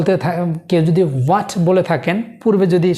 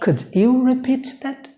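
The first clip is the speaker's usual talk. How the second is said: gentle, higher polite Could you repeat that; 6 semitones higher, much slower than usual. Shown as a demonstration. Teacher shows polite asking to learners, gentle and higher. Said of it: "নম্রভাবে বলার জন্য".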